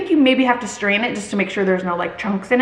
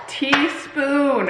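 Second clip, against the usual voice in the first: "teaspoon" drawn out slowly, each syllable separate; stress on teaspoon; 3 semitones higher than usual. Real, not acted. Creator avoids repeating her slip, "teaspoon" slow and deliberate. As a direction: spell out "teaspoon" with slow, pointed emphasis.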